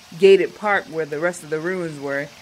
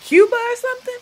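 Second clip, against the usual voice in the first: much higher, louder, teasing the tourist; high mocking question; 6 dB louder than usual. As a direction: toss the question up high like a cocky local. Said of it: "He's like".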